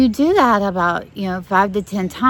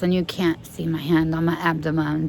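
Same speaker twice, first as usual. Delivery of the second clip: ordinary delivery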